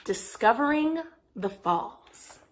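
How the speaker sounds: usual speaking voice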